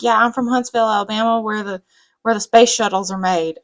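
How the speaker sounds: loud, poorly imitated Alabama accent; attempted Alabama accent. Astrophysicist introducing himself in the preview, attempted Alabama accent. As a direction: have a rough go at an Alabama accent. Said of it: "I can't do an, an, an Alabama, Alabama accent".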